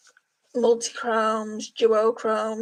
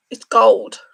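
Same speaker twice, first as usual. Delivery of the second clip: surprised exclaim; 10 dB louder than usual. Real, not acted. Surprised the blue flip shows gold.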